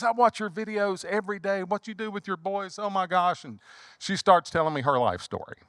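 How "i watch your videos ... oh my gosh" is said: high, admiring fan voice; high excited woman gushing. Retold or imitated. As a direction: gush like a devoted viewer. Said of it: "She goes".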